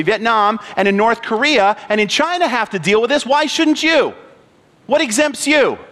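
ordinary delivery

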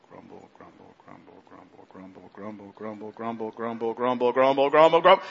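steady crescendo of grumbling. Shown as a demonstration. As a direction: start barely audible and low, swell to loud. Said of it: "We start off slow and we get louder as we go along".

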